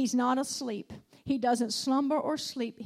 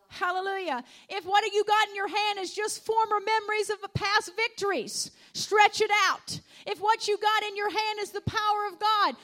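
higher, louder rising preacher's call; preaching at full force; 6 semitones higher, 5 dB louder than usual. Acted. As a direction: build the congregation up, fervently. Sermon climbs to full force.

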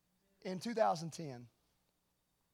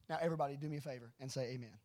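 normal talking voice

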